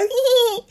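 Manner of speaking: very high squeaking giggle; squeaky mouse laugh. A narrator's reading. The little mouse giggles at the fox's tongue, high and squeaky. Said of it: "cincogva nevetett".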